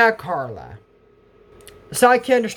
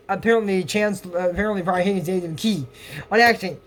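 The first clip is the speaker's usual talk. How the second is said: ordinary delivery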